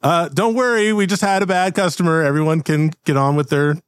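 high, hurried reassurance; smooth over the scene; nervous host voice. Acted. Owner calms startled customers, high and nervous.

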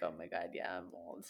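resigned mutter. Retold or imitated. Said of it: "and then I'm like".

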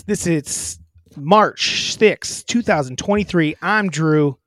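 slow loud show open; podcast host intro. Acted. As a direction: hype the show name slowly.

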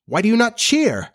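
high, indignant question; hotly. Acted. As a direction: demand it hotly, pitch climbing. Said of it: "he said hotly".

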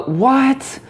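high, rising "what?" with eyes wide; feigned astonishment; fake shock. Shown as a demonstration. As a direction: lean back and gasp out "what?". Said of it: "when you say it, you actually have to lean back".

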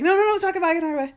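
high-pitched hurried imitation of reassuring; acted high, insistent reassurance; urgent reassurance. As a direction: protest in a high mimicking voice, starting loud. Retold hurried reassurance at a goodbye.

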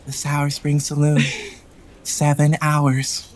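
put-on creepy child voice.

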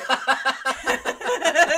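big burst of laughter; loud laugh. Pretend. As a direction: cackle loudly. Mock crowd reaction.

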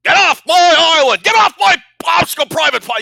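loud, high drill sergeant bellow. Retold or imitated. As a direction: roar at recruits like a drill instructor. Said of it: "Lee Ermey would, like".

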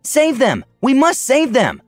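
high-pitched, drawn-out heated demand; heated plea. A narrator's reading. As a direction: plead hotly for a rescue. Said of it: "Onyxia declared hotly".

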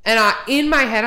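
ordinary delivery

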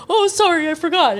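high-pitched mock apology; imitating a flustered little boy; sheepish kid. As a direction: squeak out an apology like a caught child. Child apologises to scolding parent.

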